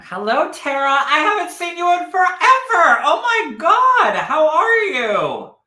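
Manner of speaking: very high, loud gushing hello; excited greeting. Real, not acted. Greeting someone long unseen.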